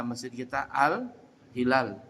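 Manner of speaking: "al-hilal" drawn out slowly, h sounded; slow model word. Shown as a demonstration. Teacher slowly modelling "al-hilal" for the class. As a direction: say "masjid kita al-hilal" slowly and clearly. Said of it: "itu pakai huruf H".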